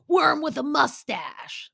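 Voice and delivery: slow, high mock yell; Sandoval. Sandoval hammering the wall, hammed-up high yell. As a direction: imitate a venting shout, words stretched out.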